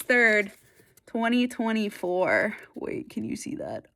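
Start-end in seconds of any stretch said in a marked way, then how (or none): 2.7-3.9 softer, breathy whisper; hushed aside. Real, not acted. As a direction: whisper it to the viewers. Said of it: "aside while reading date".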